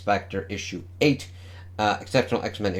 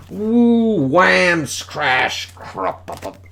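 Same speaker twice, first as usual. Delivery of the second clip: comic sound effects; 8 semitones higher, 5 dB louder, much slower than usual. Retold or imitated. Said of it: "It's like".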